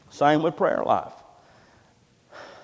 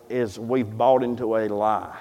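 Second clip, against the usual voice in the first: ordinary delivery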